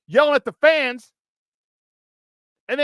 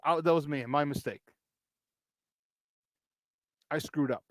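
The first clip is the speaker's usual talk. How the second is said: low, much softer imitation of an owned mistake; mock confession; 10 semitones lower, 12 dB quieter than usual. The missing safety admitting his mistake to reporters, low and hushed. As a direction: mutter it like a player quietly owning up. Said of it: "comes out and says".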